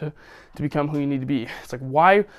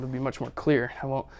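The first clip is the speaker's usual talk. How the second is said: ordinary talk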